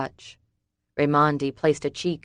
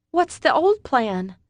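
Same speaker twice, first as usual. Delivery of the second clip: higher, slow, louder exasperated question; exasperated and afraid; 10 semitones higher, 5 dB louder than usual. A narrator's reading. Summer to David, exasperated and scared. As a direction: let fear leak through the annoyance. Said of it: "exasperation mixed with fear in her voice".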